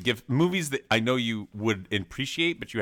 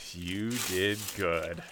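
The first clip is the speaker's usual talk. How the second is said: touched; 5 semitones lower, 5 dB quieter, much slower than usual. Real, not acted. Moved by gift.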